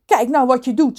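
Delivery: contained shout of reproach; mother's mild shout. Acted. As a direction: scold as an acted angry mother. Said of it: "schreeuwde Rons moeder".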